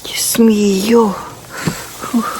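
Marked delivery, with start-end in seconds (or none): none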